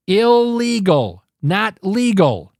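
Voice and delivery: "Illegal" slow and punched, then "not legal" slow; hammering stress. Acted. Host lecturing migrants, slow punched "Illegal, not legal.". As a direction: spell "Illegal, not legal." out slowly word by word.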